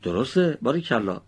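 usual speaking voice

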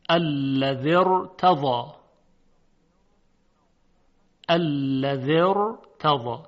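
very slow الذی ارتضی joined as one, ra full and held; heavy ra. Shown as a demonstration. Teacher models where ra is not thinned, slow heavy ra. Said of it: "در اینجا دیگه ترقیق صورت نمیگیره".

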